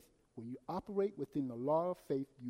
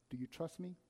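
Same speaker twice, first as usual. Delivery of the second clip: quiet voice of God; 8 dB quieter than usual. Retold or imitated. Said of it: "what the Lord said to me".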